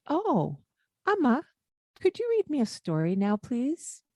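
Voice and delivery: high, sweet, fast, polite ask; polite modeling. Shown as a demonstration. Caregiver models polite request for the boy, high and sweet. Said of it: "And I'll say".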